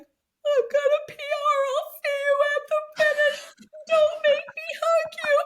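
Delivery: very high, squeaky mock wail; imitated sobbing. Runner calling to her mom mid-race, mock sobbing. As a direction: wail it as if sobbing while running past. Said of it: "and I'm sobbing".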